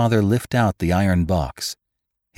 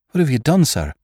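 alarmed question; 7 semitones higher, 4 dB louder than usual. A narrator's reading. Startled son confronts father.

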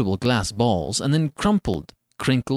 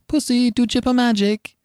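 put-on high pleading little boy; eager child; 11 semitones higher than usual. A narrator's reading. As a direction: coax the rabbit like a small child. Child begging rabbit.

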